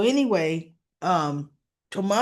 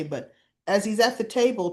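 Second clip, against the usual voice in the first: normal talking voice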